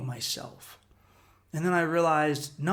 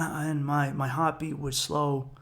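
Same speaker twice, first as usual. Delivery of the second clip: normal talking voice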